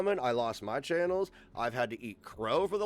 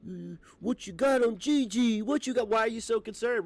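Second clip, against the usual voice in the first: higher, nasal-free sneering mock voice, much higher; mocking critics; 8 semitones higher, 4 dB louder than usual. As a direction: parody the nosy randos. Mocking critics asking about Gigi.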